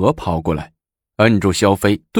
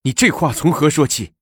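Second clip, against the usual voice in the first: higher-pitched alarmed question; astonished; 8 semitones higher than usual. A narrator's reading. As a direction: ask in startled disbelief. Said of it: "李治大吃一惊". (大吃一惊地追问)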